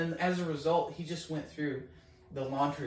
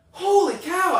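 loud, high exclamation of amazement; blown away; 13 dB louder, slower than usual. Retold or imitated. Caller reacting to the healing, blown away. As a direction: burst out in stunned awe. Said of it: "blown away".

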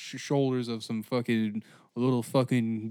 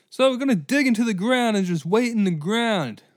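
mocking; 8 semitones higher, 5 dB louder than usual. Mocking trench generals.

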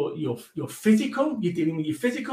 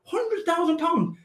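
amazed exclamation; 8 semitones higher, slower than usual. Retold or imitated. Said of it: "And I'm like".

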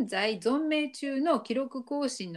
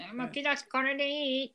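higher-pitched voice, asking if this is right; higher, tentative question; acted hesitant compliance; 5 semitones higher than usual. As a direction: lightly play an unsure pupil checking. Acting out baring teeth for Mao.

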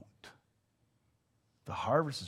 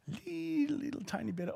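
ordinary talk, nothing marked

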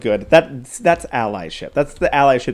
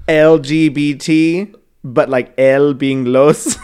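slow, loud, spelling it out; slow emphatic mock shout; emphatic mock explanation; 6 dB louder, slower than usual. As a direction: drag each letter out, then shout the last bit. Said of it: "she goes like that".

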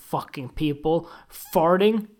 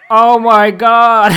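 slow, drawn-out shouted oh my God, higher and much louder; over-the-top orgasm shout; mock climax cry; 6 semitones higher, 15 dB louder, much slower than usual. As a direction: ham up a man climaxing with a long yell. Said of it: "Came all over his stomach and went".

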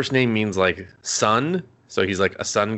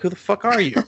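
higher-pitched incredulous challenge; mock outrage; 10 semitones higher, faster than usual. Parents meeting an uninvited girl at home, mock outrage. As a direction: imitate affronted parents confronting an intruder.

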